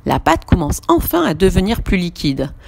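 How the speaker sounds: ordinary delivery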